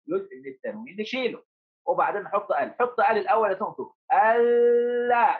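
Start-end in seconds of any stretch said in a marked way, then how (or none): 4.1-5.4 ألا with a long, hummed double L; high, very slow ألا, sustaining the L; shadda on lam. Shown as a demonstration. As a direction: hold the lam hard in ألا. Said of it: "شديت يا بطل ولا ما شديت؟".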